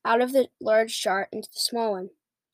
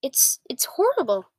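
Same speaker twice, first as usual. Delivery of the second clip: mildly horrified, stammered exclamation; horrified cry; 3 semitones higher than usual. A narrator's reading. Sophie sees the nightmare thrashing in the jar, horrified cry. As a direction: cry out in dismay. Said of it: "Sophie cried".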